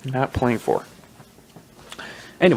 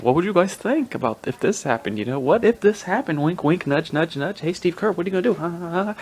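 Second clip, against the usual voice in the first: higher-pitched, goading mock questions; mock nudging reporter; 6 semitones higher than usual. Podcaster mimicking media baiting players, mockingly coy and higher. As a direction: needle them like a hinting reporter.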